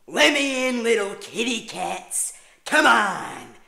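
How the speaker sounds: loud, high, drawn-out villain taunt; menacing killer taunt. Retold or imitated. Babysitter taunting kids like the Shining killer. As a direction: yell the taunt through the door. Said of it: "and I'd say".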